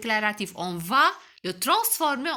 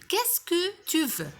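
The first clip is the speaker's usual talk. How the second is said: Qu'est-ce que tu veux read slowly, pitched up; slow question read; 7 semitones higher, slower than usual. Shown as a demonstration. Teacher reads the exercise question slowly to pupils. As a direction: spell out the question slowly for the class. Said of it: "écris interrogatif si la phrase est interrogative".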